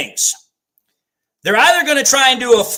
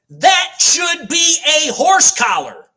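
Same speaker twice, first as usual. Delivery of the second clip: slow emphatic shout; 4 semitones higher, slower than usual. Real, not acted. Streamer yelling at the refs: slow emphatic shout. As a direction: shout it slowly, leaning on horse. Ranting at the referees' call.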